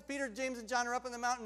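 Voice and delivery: usual speaking voice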